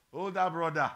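loud, teasing call of the title. Shown as a demonstration. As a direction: call it out to the congregation, loudly. Mockingly calls out the elder brother.

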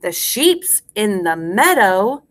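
slow, full-voiced nursery rhyme line; slow rhyme recitation. Shown as a demonstration. Teacher gives class a line to repeat, slow and clear. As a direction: space it out for repeating.

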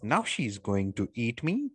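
ordinary talk

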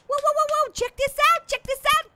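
high, eager excited calling. Real, not acted. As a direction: call the viewers over excitedly. Excited at finding the gas can.

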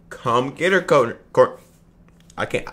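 ordinary talk

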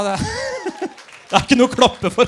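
ordinary delivery

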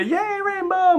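very high falsetto cheer; mock cheer. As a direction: squeal it like a little fan.

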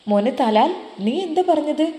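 high, loud and startled; shocked question. Acted. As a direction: gasp it out in disbelief. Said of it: "അറബിയുമ്മ ഞെട്ടിപ്പോയി". (ഞെട്ടലോടെ ഉയർന്ന ശബ്ദത്തിൽ)

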